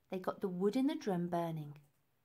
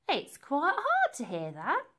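lady's fussy quip; 6 semitones higher, 6 dB louder than usual. Acted. Lady joking, fussy.

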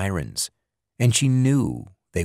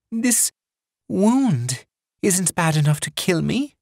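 higher, gasping female voice; forced out between breaths; gasped; 10 semitones higher than usual. Acted. Pauline gasping her question to Ritter after swallowing lead. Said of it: "she gasped".

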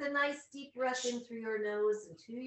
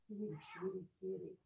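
soft kitty call; 2 semitones lower, 6 dB quieter, much faster than usual. Real, not acted. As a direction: coax the cat over quietly. Calling the cat over.

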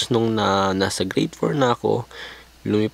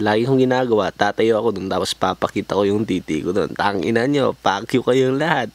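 ordinary talk, nothing marked

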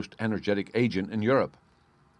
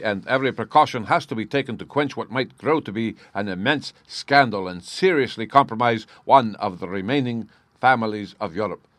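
loud, raised, formal nobleman's voice; imperious Bohemian count; 4 semitones higher, 7 dB louder than usual. Acted. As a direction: speak as a grand, guarded client.

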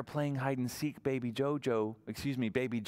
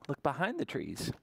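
ordinary delivery

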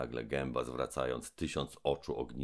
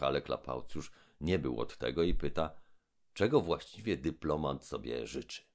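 usual speaking voice

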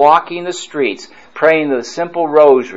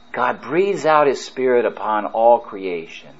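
ordinary delivery

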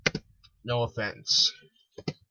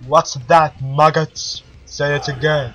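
loud sergeant barking for a louder answer; drill sergeant; 9 dB louder than usual. Acted. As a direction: demand they shout it back.

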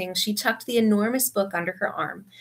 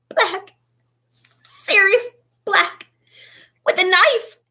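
high, loud panicked gasp; terrified; 12 semitones higher, 6 dB louder, much slower than usual. A narrator's reading. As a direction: gasp it out in terror. Ron terrified after attack.

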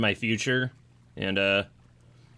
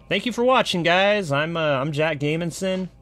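high, bright sign-off; cheerful thanks to viewers; upbeat outro; 6 semitones higher than usual. Real, not acted. Channel outro.

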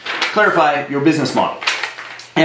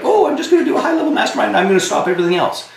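higher, fast, breezy overconfident voice; mocking naive client; 9 semitones higher, faster than usual. Coach mimics a client ditching everything for a high-end mastermind, higher, fast and breezy. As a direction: rattle it off like a carefree know-it-all. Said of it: "which is like".

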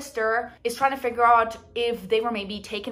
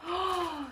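soft awed gasp; 7 semitones higher, 8 dB quieter than usual. Real, not acted. Unwrapping a gorgeous book.

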